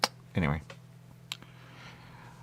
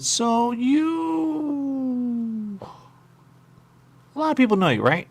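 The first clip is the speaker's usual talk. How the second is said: high put-on voice; 14 semitones higher, 3 dB louder, much slower than usual. Character voice questioning suspect.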